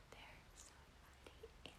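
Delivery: hushed, breathless whisper. Retold or imitated. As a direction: whisper it so no one hears. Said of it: "he's like".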